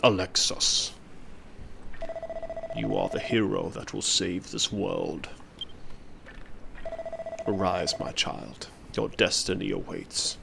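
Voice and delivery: prophetic trance voice. Acted. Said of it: "That's a good voice".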